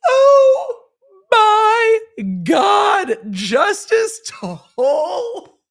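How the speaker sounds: high, loud gasp of delight; real thrilled outcry; excited shout. Real, not acted. Reacting to judge's rebuke.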